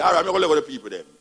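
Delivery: fast, hurried concession; rushed. Retold or imitated. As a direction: gabble it out to get it over. Pharaoh hastily gives in.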